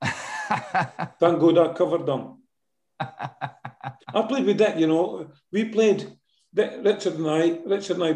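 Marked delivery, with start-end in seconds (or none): 0.0-4.2 laughing. Real, not acted.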